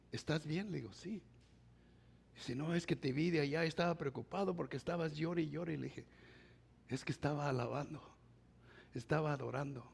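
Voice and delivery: soft retold dialogue. Retold quiet exchange with pastor.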